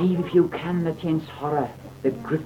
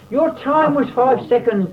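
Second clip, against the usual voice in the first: much higher and louder, triumphant announcement; president crying out; 9 semitones higher, 6 dB louder than usual. A narrator's reading. President announces John's winning time, high and loud. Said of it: "the president cried".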